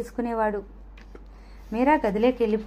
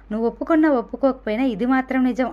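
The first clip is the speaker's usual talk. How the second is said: ordinary delivery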